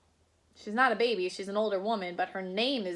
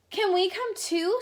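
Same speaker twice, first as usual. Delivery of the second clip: much higher, pleading lightly; eager girl; 9 semitones higher, 3 dB louder than usual. Acted. Little girl asking to join, high and eager. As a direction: ask hoping to be included.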